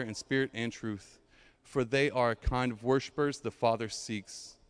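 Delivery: low, measured Bible reading; scripture reading. Acted. Pastor reads scripture passage aloud.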